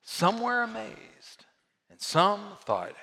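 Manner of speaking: normal talking voice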